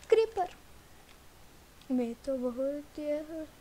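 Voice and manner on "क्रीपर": high, loud startled call; a short jolt of alarm at a threat. Real, not acted. Player spotting a creeper, startled.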